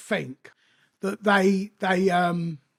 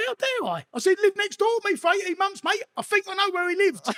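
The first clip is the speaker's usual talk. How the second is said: much higher, mock-outraged retort; incredulous comeback; 10 semitones higher than usual. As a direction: throw the question back, pitched way up in disbelief. Retold indignant comeback in court.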